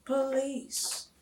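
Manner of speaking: plain reading voice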